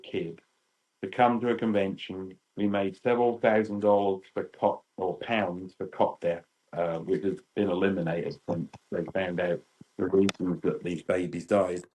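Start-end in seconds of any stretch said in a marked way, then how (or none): none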